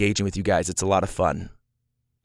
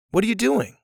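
light, high, friendly question; innocent curiosity; 10 semitones higher than usual. Shown as a demonstration. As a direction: ask it curiously, with no edge at all. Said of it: "innocent curiosity of like".

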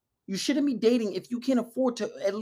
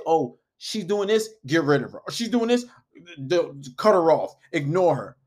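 curt dismissal; 2 semitones lower, 5 dB louder than usual. Retold or imitated. Mimics red pill coaches' blunt advice.

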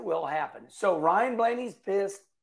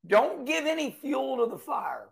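ordinary delivery

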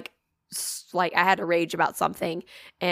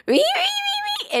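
lost-voice squeak; much faster than usual. Retold or imitated. Host imitates her failing voice on a phone call, squeaking. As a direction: squeak it out like a voice giving out. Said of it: "obviously I sound like this".

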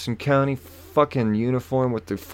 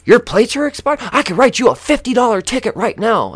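high, loud, barging-in threat; mimicked angry officer; 11 semitones higher, 7 dB louder than usual. Officer flying around the corner, mock high-pitched outburst. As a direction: burst in shouting the threat. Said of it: "she comes flying around the corner".

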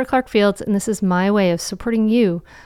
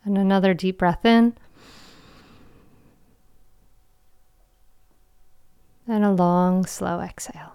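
guided meditation. Acted. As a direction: guide them into calm, with long pauses.

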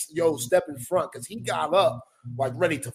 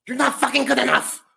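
high, strained angry outburst; losing it; 9 semitones higher, 3 dB louder than usual. Retold or imitated. Coach to a player asking for playing time, angry outburst. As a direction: imitate a coach blowing up. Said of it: "He just lost it".

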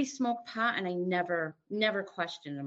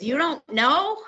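higher, louder, slowed disbelief; a husband stunned she can't remember; incredulous husband; 7 semitones higher, 8 dB louder, slower than usual. Retold or imitated. Husband asking his wife in disbelief, louder and higher. Husband's quote after blackout.

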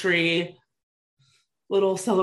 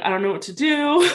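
teary helplessness; 8 semitones higher, 3 dB louder than usual. Retold or imitated. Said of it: "kinda, like, crying now at this point".

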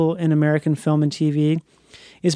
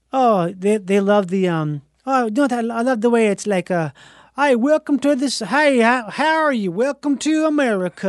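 put-on Italian accent; 3 semitones higher than usual. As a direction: gush like an Italian fan of America. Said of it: "Italians are like".

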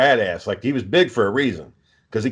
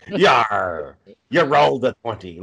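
put-on pirate voice with a drawn-out "Yar"; pirate accent; 3 semitones lower, slower than usual. Shown as a demonstration. Game master announcing a dice roll, hammy pirate voice. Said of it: "they always end up being a pirate".

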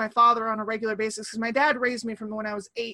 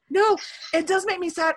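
ordinary talk, nothing marked

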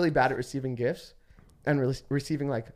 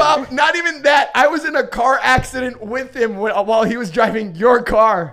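loud, excited outburst over the others; shouting; 6 semitones higher, 9 dB louder than usual. Real, not acted. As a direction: yell to cut in on the story.

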